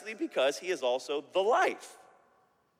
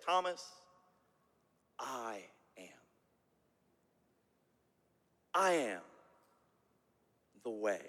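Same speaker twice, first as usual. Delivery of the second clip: soft, solemn Jesus; 9 semitones lower, 7 dB quieter than usual. Retold or imitated. Preacher voicing Jesus gently to Thomas, soft and solemn. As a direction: let each word settle quietly, almost breathed. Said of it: "Jesus says to Thomas".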